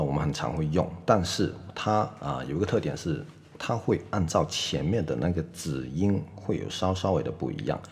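ordinary delivery

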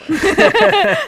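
sudden high loud laugh; a high laugh bursting out; real burst of laughter. Real, not acted. Reacts to cranberry juice joke.

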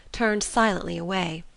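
usual speaking voice

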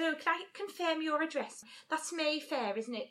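carefully articulated fake-posh Liverpudlian; Liverpudlian trying to be posh. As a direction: imitate a Scouse shop caller putting on posh. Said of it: "she's talking like that".

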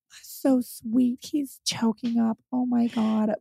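high, soft, slow gushing coo; gushing guests. Retold or imitated. Wedding guests coo over the emotional dad, gushing. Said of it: "everyone else was like".

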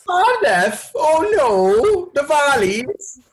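put-on very high, singsong Welsh accent; high-pitched mock Welsh; attempted Welsh accent. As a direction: squeal it in a put-on Welsh lilt. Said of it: "the Welsh accent's so hard to do".

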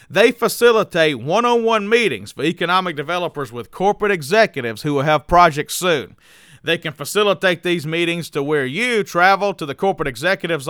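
sponsor ad read. Acted. Host pitching a lead generation firm to listeners, punchy ad read. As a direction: sell it like a podcast sponsor spot.

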